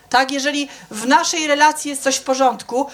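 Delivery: ordinary talk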